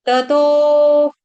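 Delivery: ततो slow with single dental t; drawn-out ततो, tongue-to-teeth t; dental consonant demo. Shown as a demonstration. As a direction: hold the final o of ततो. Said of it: "त तरबूज का".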